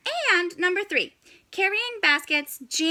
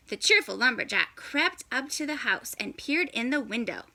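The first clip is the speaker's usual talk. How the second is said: usual speaking voice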